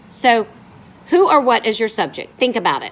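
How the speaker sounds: normal talking voice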